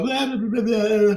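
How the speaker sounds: high run of mocking blahs; mock dismissal. Imitating his old self tuning out mental health talk, high mocking babble.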